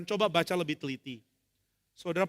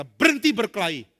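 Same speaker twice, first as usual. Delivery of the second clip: commanding; 3 semitones higher, 8 dB louder than usual. Acted. Musa stops the fight.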